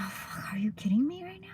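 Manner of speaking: awed whisper; hushed amazement at the bakery. Retold or imitated. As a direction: breathe it like a secret thrill. Retold awe at organic bread.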